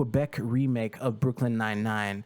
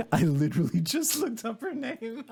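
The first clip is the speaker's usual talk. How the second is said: much higher, helpless laughter through the words; laughing; 10 semitones higher than usual. Real, not acted. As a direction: crack up while talking, real laugh. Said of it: "I shouldn't laugh".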